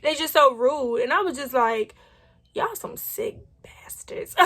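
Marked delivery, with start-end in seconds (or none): 2.5-4.3 whispered, slow insult under the breath; angry whisper. Retold or imitated. Muttering about rude station staff, slow angry whisper. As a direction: spit it out quietly so they can't hear.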